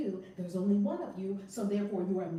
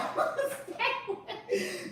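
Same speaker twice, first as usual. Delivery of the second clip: long laughing burst; laughing fit; 13 semitones higher than usual. Real, not acted. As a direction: lose it laughing. Laughing at grandma story.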